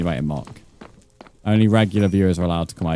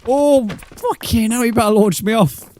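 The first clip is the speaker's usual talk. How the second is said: much higher, slow yelp of shock; high startled shriek; 4 dB louder, slower than usual. Real, not acted. Knocked back by a mob, shrieking. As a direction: cry out as he is hit.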